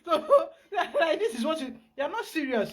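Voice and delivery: words squeezed through real laughter; laughing. Real, not acted. Pastor mocking the client's line to the spirits, laughing.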